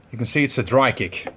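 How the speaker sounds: normal talking voice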